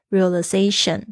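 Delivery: ordinary delivery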